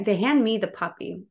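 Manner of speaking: ordinary delivery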